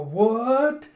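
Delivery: high, loud, rising outburst; freaked-out shock. Acted. Whole group learning who he is, freaking out. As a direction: shout it in stunned disbelief. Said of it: "As everyone starts freaking out".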